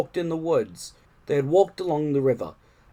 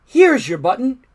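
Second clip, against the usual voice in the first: high, louder friendly frog voice; reassuring Frog; 9 semitones higher, 7 dB louder than usual. A narrator's reading. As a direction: Frog cheerfully promising help. Frog offers a button.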